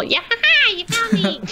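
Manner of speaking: very high-pitched, gleeful Korok voice; Korok impression. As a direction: giggle and pipe up like a tiny forest sprite. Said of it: "that was an eerily good impression of Korok".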